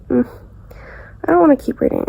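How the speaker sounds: usual speaking voice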